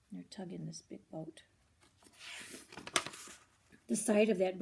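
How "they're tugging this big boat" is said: soft, low explaining aside; quiet aside. Real, not acted. Reader softly explaining the picture to a child.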